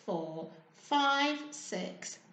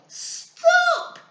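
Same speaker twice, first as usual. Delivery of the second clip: very high, much louder shout; whistle-stop shout; 10 dB louder than usual. A narrator's reading. Mum stopping the quarrelling spiders, loud high shout. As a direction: cut the quarrel dead. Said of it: "she said".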